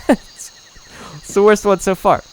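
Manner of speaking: ordinary delivery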